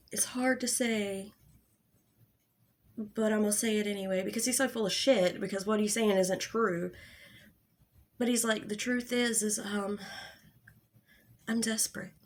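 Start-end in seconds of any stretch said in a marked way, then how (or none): 0.1-4.2 hushed, mock-earnest admission; mock serious. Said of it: "he gets all serious". 8.7-12.1 softly imitated grave confession; hesitant put-on earnestness, trailing softer; mock serious. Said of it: "he gets all serious".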